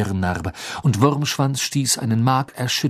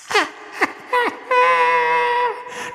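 shrill, joyless villain cackle; cold high laugh. A narrator's reading. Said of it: "lachte nur".

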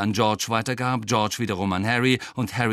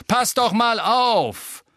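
high, loud, drawn-out reprimand; brusque scolding shout; 12 semitones higher, 5 dB louder, much slower than usual. A narrator's reading. Angelina scolds Ron for missing, brusque shout. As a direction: yell a telling-off after them. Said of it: "in barschem Ton".

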